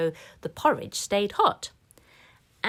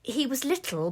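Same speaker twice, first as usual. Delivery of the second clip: usual speaking voice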